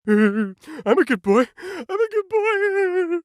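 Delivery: put-on slow, high childish whimper; little kid pleading. Kid begging mom for M and Ms, slow and high. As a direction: play a sweet little boy begging.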